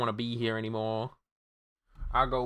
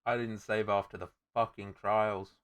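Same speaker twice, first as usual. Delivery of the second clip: dejected muttering; 9 dB quieter than usual. Real, not acted. Defeated after losing progress.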